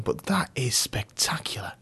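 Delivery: hushed breathy awe; awed whisper. A narrator's reading. Girlfriend marvelling at the brooch, whispered. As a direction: breathe it in wonder.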